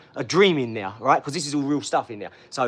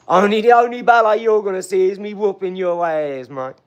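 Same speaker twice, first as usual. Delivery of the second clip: loud, higher-pitched boast; loud trash talk; 4 semitones higher, 8 dB louder than usual. Real, not acted. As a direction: crow it out loud at a rival.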